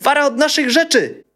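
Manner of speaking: very high, loud squeak; squeaky shout. A narrator's reading. Boy squeaking at the bandits to leave their things. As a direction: squeak defiantly at the robbers. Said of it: "pisnął".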